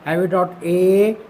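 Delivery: slow, stretched ए with a rise; heavy dot ए, ए held long; long heavy ए. Shown as a demonstration. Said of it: "इसको हम जाहिर करते हैं light से".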